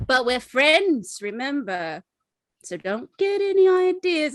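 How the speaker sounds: high, emphatic tipsy warning; playful warning. Acted. Character playfully warns Clay off.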